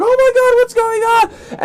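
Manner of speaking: very high, loud mock panic; crowd freaking out. Crowd watches a tackle on stage, freaking out loudly. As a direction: shriek like a panicking onlooker. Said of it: "everybody just freaks out".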